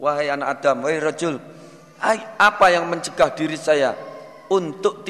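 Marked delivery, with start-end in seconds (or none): none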